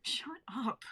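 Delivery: whispered through tears; half-laughing whisper; tearful whisper. Acted. Rose crying, embarrassed.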